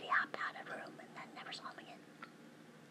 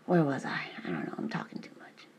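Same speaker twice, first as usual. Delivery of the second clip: whispering; 8 dB louder than usual. Real, not acted. Whispers through most of her talk.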